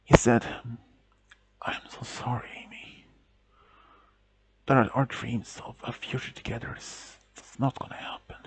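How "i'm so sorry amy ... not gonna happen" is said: dying man's faint whisper; faint breathy whisper of a dying man; weak whisper. A narrator's reading. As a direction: barely get the words out, whispering. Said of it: "some of the words didn't come out strong enough".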